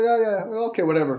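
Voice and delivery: high, careless yeah yeah; mocking dismissal of a silly tip; dismissive brush-off. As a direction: wave the advice off like a joke. Guy brushes off guide's advice.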